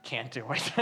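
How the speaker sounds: low, soft, defeated murmur; quiet surrender. Retold or imitated. Preacher voicing a tired believer to God, low and soft. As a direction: let it sink low and soft.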